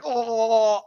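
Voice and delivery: short loud horrified "Oh!"; horrified gasp. Retold or imitated. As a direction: gasp it out in horror. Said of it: "faces that are going".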